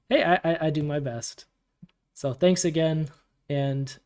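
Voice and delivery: ordinary talk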